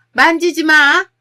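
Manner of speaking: loud, high-pitched shout of alarm to stop someone; mother-in-law's sudden yell from behind. Acted. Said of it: "소리를 치면서 말이죠". (버럭 소리치며)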